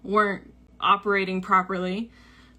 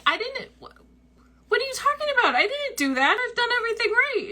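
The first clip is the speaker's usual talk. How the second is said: much higher, flustered defensive protest; indignant disbelief; 13 semitones higher, faster than usual. Retold or imitated. Defending herself to her friend, flustered and indignant.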